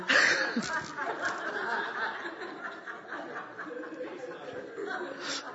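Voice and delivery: long airy wheezing laugh; breathless laugh. Real, not acted. Pastor cracks up about his wife's warning, airy laughter. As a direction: silent helpless laughter.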